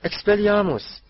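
high, loud incantation; shouted spell. A narrator's reading. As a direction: cast the spell with full force. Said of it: "بیست جادوگر همصدا گفتند". (ورد را با فریاد گفتن)

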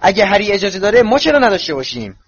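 high, indignant yell; shouted protest. A narrator's reading. Boy protests to his mother at being left out, shouting. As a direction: call out loudly at the unfairness. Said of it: "رون فریاد کشید".

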